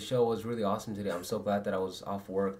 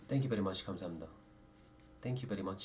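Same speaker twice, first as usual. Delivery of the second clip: put-on Korean accent; 8 dB quieter, much faster than usual. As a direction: thank them in a Korean accent. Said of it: "Say thank you again, but in your Korean".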